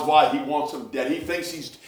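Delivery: ordinary talk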